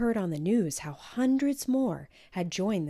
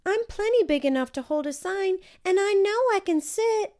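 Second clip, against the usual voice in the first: put-on very high and loud child pleading; a small girl insisting she can come; high eager child voice; eager little girl; 14 semitones higher, 8 dB louder than usual. A narrator's reading. Child insisting she's big.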